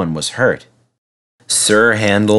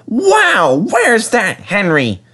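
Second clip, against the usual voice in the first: put-on higher apologetic young engine voice; little engine; 12 semitones higher, slower than usual. A narrator's reading. Peter Sam apologising to the refreshment lady, sorry little engine. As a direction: sound small and sorry.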